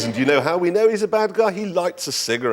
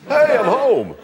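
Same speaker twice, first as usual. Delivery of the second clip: American voice; 9 semitones higher, 4 dB louder, slower than usual. Pretend. Mock American arrival.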